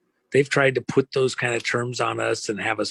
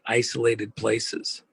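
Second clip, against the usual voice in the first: ordinary delivery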